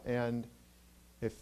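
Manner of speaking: normal talking voice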